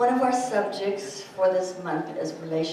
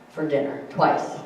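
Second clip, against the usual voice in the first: ordinary talk, nothing marked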